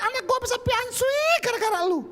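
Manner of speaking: very high, sustained mock wail; imitated scolding mother-in-law. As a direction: screech it like a hammed-up outraged mother. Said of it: "Waktu Mama saya cobain sayur asinnya, saudara, gini".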